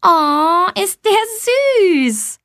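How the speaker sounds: put-on high, drawn-out girlish cooing; delighted calling out. A narrator's reading. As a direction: squeal over a cute dog, stretching the words. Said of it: "Ein Mädchen ruft laut".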